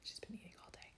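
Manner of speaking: half-whispered aside about the dog; hushed aside. Real, not acted. To viewers about Piper upstairs, hushed. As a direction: drop to a hush, as if not to disturb.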